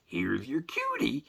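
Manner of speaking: high, drawn-out singsong presentation; imitating a gushing groomer; cheery groomer. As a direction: coo it high, like handing over a darling pet. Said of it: "she's all smiling and everything".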